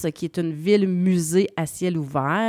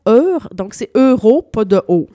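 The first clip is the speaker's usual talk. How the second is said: Italian pronunciation; 3 semitones higher, 10 dB louder than usual. Shown as a demonstration. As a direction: try saying Eur the Italian way. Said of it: "mon accent italien". (Eur avec l'accent italien)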